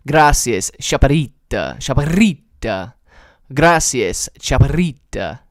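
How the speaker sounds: loud, careful "Gracias, chaparrita" with rolled r; trying Spanish. Shown as a demonstration. Streamer attempting a Spanish phrase from chat, loud careful pronunciation. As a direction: sound out "chaparrita" loudly, stretching the stressed "ri". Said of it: "I'll try it".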